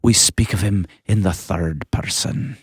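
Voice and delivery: a half-hearted Scottish brogue, preacher-like; weak Scottish accent. Retold or imitated. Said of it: "That was my weak Scottish accent".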